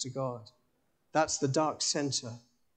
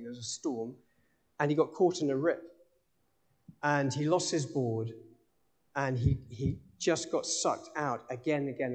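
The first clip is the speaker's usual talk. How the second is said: ordinary delivery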